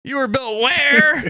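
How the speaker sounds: very high, stretched-out silly voice; high put-on squeal. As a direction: drag it out in a squeaky mock shriek. Continuing the put-on robot bit.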